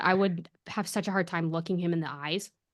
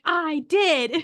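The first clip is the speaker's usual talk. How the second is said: raised, loud defensive imitation; mock protest; 7 semitones higher, 6 dB louder than usual. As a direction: insist indignantly, playing someone else. Harry insists again, retold.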